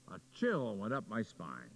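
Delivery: ordinary talk, nothing marked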